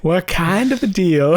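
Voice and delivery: high hammed-up boy voice; broken adolescent voice. Co-host joins in mimicking young John, broken voice. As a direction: imitate a squeaky teenage boy.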